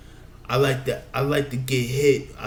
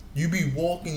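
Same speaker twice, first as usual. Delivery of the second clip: ordinary delivery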